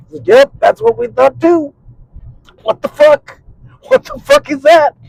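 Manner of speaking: two buddies trading incredulous looks; mock disbelief. Him and buddy reacting.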